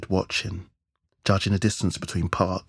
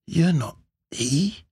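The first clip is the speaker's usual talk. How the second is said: high, drawn-out, rising disbelief; incredulous question; 9 semitones higher, slower than usual. A narrator's reading. Pinned man questioning his assailant, incredulous. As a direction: ask it slowly, pitch leaping up in disbelief. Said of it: "Park mumbled under the heavy mass".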